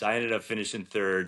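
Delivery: ordinary talk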